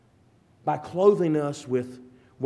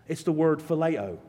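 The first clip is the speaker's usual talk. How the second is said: normal talking voice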